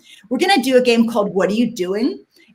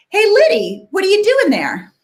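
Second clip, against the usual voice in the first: cheerful call; 9 semitones higher, 4 dB louder than usual. Shown as a demonstration. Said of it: "And I'll say".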